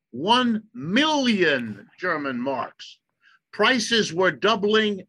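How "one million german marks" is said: slow, weighty build to "one million German marks"; dramatic reveal. Real, not acted. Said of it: "ready now".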